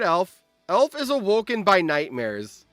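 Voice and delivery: ordinary talk, nothing marked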